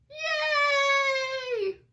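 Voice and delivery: very high, loud shouted yay; a crowd of kids cheering. Acted. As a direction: cheer as loud as you can.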